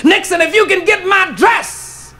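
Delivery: slow taunting build to a high shout; building sass. Acted. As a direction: lay out each condition slowly, then climb to a shouted punchline. Woman's slow building comeback to Nixon.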